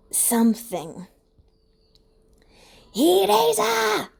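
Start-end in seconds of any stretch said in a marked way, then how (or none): 2.9-4.1 high, loud, slow scream of a name; a relieved shriek across the field. A narrator's reading. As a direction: stretch the name out in a high yell. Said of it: "Mike screams".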